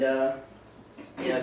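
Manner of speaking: ordinary delivery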